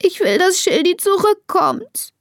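put-on high, pleading child's wail; sobbing. A narrator's reading. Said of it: "schluchzt Elisa".

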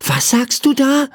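put-on high-pitched curious child voice; curious little boy. A narrator's reading. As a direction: ask it as a wondering boy. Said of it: "fragte ihn Michael neugierig". (neugierige Kinderstimme)

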